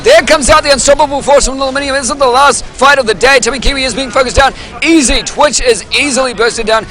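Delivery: high, loud, fast esports casting; live play-by-play. Acted. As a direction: call the fight like a hyped caster.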